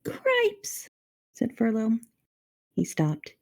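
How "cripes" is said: very high startled squeak of a voice; startled mouse. A narrator's reading. Mouse spots his brother with the princess, startled.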